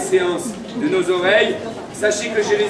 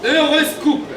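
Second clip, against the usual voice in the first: town crier; 6 semitones higher, slower than usual. Acted. Calling out horoscope signs.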